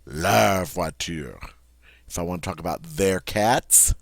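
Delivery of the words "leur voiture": leur voiture said slowly, open French vowel. Shown as a demonstration. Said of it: "Their car".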